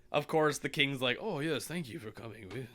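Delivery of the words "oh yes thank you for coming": soft, understated royal welcome; a hushed touch of a gracious king; lightly voiced king. King welcoming the summoned kids, lightly voiced.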